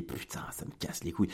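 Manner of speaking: whispered curse, voice gone; unvoiced inner grumble; muttered under breath. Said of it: "Et je me dis". (juron chuchoté, à voix basse)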